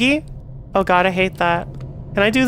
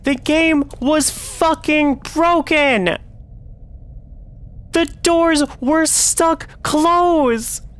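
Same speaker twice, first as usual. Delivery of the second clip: higher, slowed exasperated yell; yelling in frustration; 4 semitones higher, slower than usual. Real, not acted. Streamer ranting to chat about the softlocked game, a high exasperated yell. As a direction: rant at the broken game, stretching the words. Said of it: "I'm sorry for yelling".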